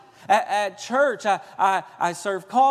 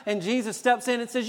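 ordinary delivery

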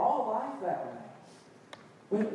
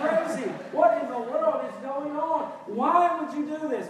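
high-pitched hammed-up disbelief; mock shocked reaction; 6 semitones higher, 4 dB louder than usual. Parent demanding answers from a sinning child, mock shocked. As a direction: exclaim in exaggerated dismay. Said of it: "It's not just reacting".

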